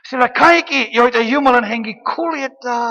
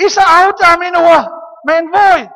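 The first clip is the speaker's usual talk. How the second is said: higher and louder, crying out to Father; desperate cry for help; 9 semitones higher, 10 dB louder than usual. Acted. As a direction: call out like someone going under. Acts a believer crying out. (epätoivoinen avunhuuto)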